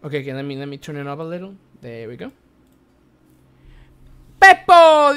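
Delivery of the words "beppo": high, very loud hail; shouted greeting. Real, not acted. Streamer spotting a viewer in chat, shouted greeting. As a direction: call the name out long and loud.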